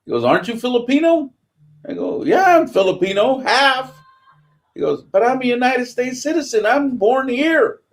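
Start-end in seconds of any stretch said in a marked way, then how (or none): 0.2-1.3 high, pointed chief's question. Retold or imitated. 2.2-7.8 high, emphatic reply; retold answer to the chief, pitched up; insistent young sailor.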